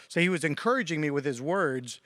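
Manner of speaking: ordinary talk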